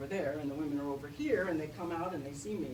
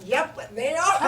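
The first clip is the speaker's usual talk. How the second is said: shocked agreement; 6 semitones higher, 11 dB louder, slower than usual. Retold or imitated. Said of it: "I am so shocked".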